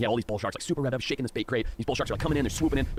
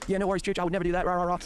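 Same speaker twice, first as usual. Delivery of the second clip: put-on breezy, carefree reckless kid, pitched higher; imitating Andrew; 9 semitones higher than usual. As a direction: toss it off like a cocky kid who isn't listening. Mocking Andrew's careless promise.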